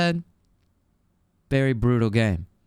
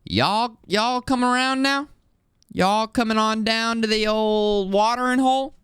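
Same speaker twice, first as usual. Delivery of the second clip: high hammed-up Southern drawl; playful cowboy voice calling folks to the watering hole. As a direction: stretch it out like a mock Texas cowboy. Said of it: "never really say y'all".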